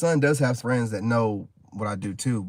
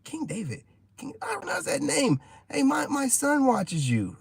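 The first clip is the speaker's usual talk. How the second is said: higher excited fan voice; woman recognizing him. Retold or imitated. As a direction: squeal like a fan who spotted a star. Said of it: "She's like".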